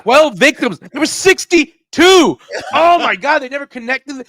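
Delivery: loud, high mock outrage; indignant, exasperated exclaiming in character; pissed-off killer. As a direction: act appalled at the podcast's mistakes. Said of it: "he's getting all pissed".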